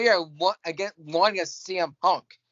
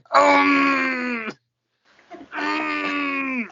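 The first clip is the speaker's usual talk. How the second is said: long, higher-pitched put-on moan; mock moaning; 8 semitones higher, 3 dB louder than usual. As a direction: draw out an exaggerated moan. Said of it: "you sound like you're moaning".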